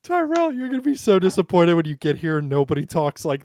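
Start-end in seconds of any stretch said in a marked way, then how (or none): none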